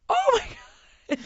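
high, slow, crying out in horror; horrified cry. Retold or imitated. Mother watching the fireworks, high horrified cry. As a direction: wail it in shock. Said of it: "I'm like".

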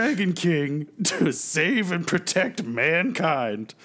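lofty preachy voice; pompous noble dragon. Retold or imitated. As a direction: proclaim it grandly.

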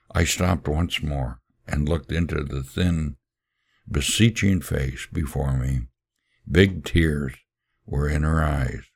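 usual speaking voice

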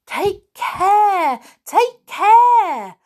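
high alarmed shout; cat shouting as the hobgoblin tumbles about; crying out. A narrator's reading. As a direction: call out in alarm. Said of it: "cried Gobelino".

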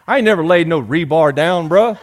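ordinary talk

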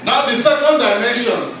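high-pitched, insistent sermon delivery; urgent exhortation, pitch held high; impassioned preaching. Real, not acted. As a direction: drive each phrase home to the congregation. Preaches at raised intensity.

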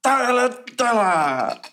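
mock German chant, high start; German accent. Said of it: "do the German accent really well".

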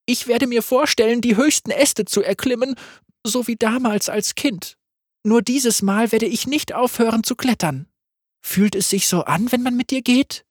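viel höher, leise und gefasst; hohe Magga-Stimme. Acted. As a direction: eine junge Frau träumt sich fort. Magga speaks, high voice. (Magga spricht hoch und verträumt)

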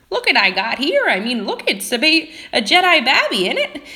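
put-on high, loud old hunchback voice; weird old man. Hunchback showing off a Jedi baby, weird old man voice. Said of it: "going back to my weird old man".

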